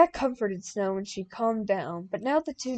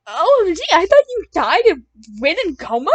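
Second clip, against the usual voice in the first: shocked; 8 semitones higher, 12 dB louder than usual. A narrator's reading. Shocked at teacher's return.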